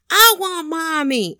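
high, slow mock bawl; mimicked tantrum. Dad taking his turn at crying for mommy, high slow mock wail. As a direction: imitate a dad copying his child's whiny fit. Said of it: "wait, my turn".

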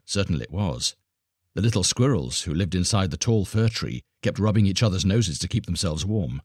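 ordinary delivery, nothing marked

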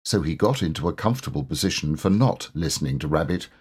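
plain reading voice

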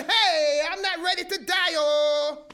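loud, high cry against death; panicked holler. Retold or imitated. Woman in a shaking embassy, crying out in terror. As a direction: holler in terror that you're not ready to die. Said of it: "And I screamed and I hollered".

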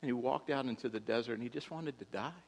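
ordinary delivery

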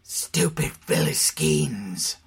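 slow contemptuous snarl. Acted. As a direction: snarl the insult slowly. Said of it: "Wesley snarled".

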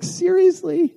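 high, exasperated "Seriously?" in disbelief; incredulous. Retold or imitated. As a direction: squeak out your disbelief. Exasperated inner voice, you're like.

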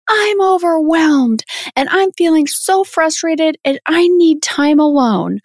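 put-on high-pitched upset little girl; imitated upset child. Mom retells her six-year-old's outburst, imitated upset child. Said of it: "just crying and crying".

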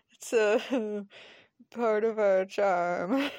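slow, halting words broken by giggles; laughing through words. Acted. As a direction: try to get it out while laughing. Laughing through acted dialogue.

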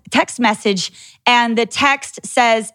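normal talking voice

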